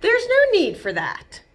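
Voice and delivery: a bird calmly settling a tantrum; lightly voiced toucan calming Betty; mildly firm toucan. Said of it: "said Mister Toucan".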